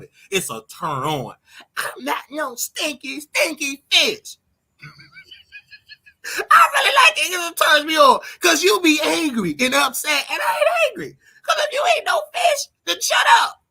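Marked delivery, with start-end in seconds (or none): 1.8-4.4 high, slow mock-offended whine. Mocking the offended critics again, high slow whine. 6.5-13.6 high, loud laughing shout; laughing taunt. Real, not acted. Taunting angry viewers, loud laughing shout.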